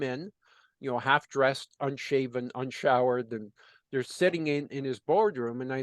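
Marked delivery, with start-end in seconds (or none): none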